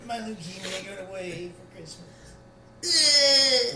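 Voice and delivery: put-on high, slow crying child; dying child voice. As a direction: whimper it like a sick kid.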